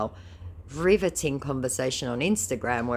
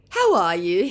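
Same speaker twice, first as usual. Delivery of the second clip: mock scammer; 6 semitones higher, 8 dB louder than usual. Voicing scammer greeting.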